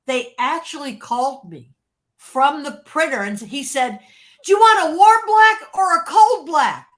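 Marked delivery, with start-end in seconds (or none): 4.5-6.9 higher, much louder quoted question; loud mock query. Printer calling the designer on vacation, loud mock query. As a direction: imitate the printer, loud and high.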